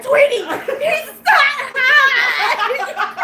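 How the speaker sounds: high, loud drawn-out mock cry; laughing imitation. Friend imitates plea to Tweety, high laughing wail. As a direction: wail it while cracking up.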